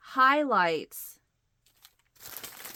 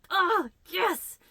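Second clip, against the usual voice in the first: high, louder oh yes of delight; thrilled; 10 semitones higher, 4 dB louder than usual. Retold or imitated. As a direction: cheer it out like you found treasure. Spots something she wants.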